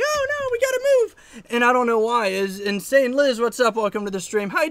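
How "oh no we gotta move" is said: squeaky, very high fake panic; mock panicked traveler. Mocking overreacting travelers in the airport, a very high squeal. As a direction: squeal it like a frantic passenger.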